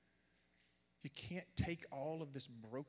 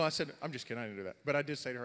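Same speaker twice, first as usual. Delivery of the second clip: normal talking voice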